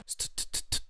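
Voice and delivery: voiceless, spaced-out "t-t-t-t" ticks; imitated stutter effect. Shown as a demonstration. Producer showing the effect to add on the reverb tail, imitated stutter. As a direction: mimic a stuttering echo with the tongue. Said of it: "un truc qui fasse".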